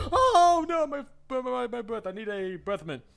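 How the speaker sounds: high, flustered squeaky panic; flustered victim. Pretend. Coaching a fighter, mocking a flustered opponent. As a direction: play the flustered opponent fumbling for a mint. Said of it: "they'll be like".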